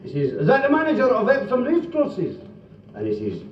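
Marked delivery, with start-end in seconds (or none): 0.3-2.4 high-pitched comic caller voice; put-on high telephone voice; phone caller. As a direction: ask it all up high. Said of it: "The guy phones up Epsom Racecourses".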